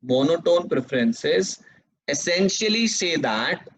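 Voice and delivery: very slow, each phrase repeated; teacher dictating notes word by word; slow dictation. Shown as a demonstration. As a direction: dictate the definition for students to copy. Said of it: "dictating second definition".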